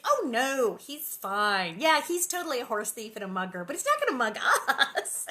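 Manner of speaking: loud, carefree dismissal with a laugh; cheerful mock reassurance; breezy Pa. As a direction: brush off the danger cheerfully. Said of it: "he's like".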